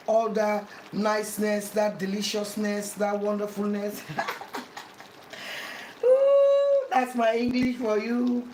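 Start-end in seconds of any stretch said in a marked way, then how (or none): 6.0-6.8 a sudden high delighted "ooh"; short joyful shriek; high squeal. Real, not acted. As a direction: squeal with glee at your own words. Delighted squeal at own English.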